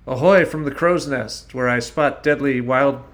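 loud pirate lookout call; shouting from crow's nest. Acted. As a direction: bellow it down from the mast.

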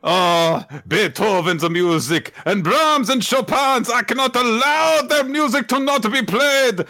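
high, grandiose declaiming; hammed-up passionate vow; melodramatic proclamation. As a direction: proclaim it like an operatic hero. Acted grand vow to play.

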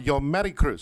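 put-on host's rough go at a Spanish accent; attempted Spanish accent. Host copies guest's name, attempting her Spanish accent. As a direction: imitate the Spanish tapped r, not quite nailing it. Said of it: "See, I can't do that".

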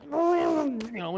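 strained, choked-off voice fading out; mock tongue-tied straining; words stuck. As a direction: act out words jamming in the throat. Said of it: "the words just stuck".

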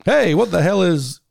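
game show host. Retold or imitated. Co-host recalling the show's title, mimicking its host.

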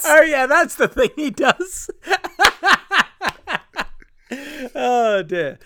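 high, loud laughing words; cracking up. Real, not acted. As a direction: laugh through it. Laughing at own flub.

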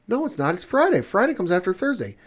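very high, indignant; squeaky stubborn argument. Retold or imitated. Man arguing with his date about the day, very high. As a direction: argue like a know-it-all.

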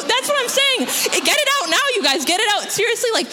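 very high, squealing incredulity; high-pitched, worked-up outburst; outraged disbelief. Real, not acted. Outraged disgust at the revelation.

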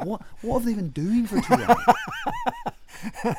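high, drawn-out incredulous cry through laughter; mock incredulous. Traveller baffled by a two-hour food delay, incredulous. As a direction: wail it loudly in comic disbelief.

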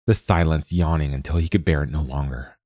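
ordinary delivery, nothing marked

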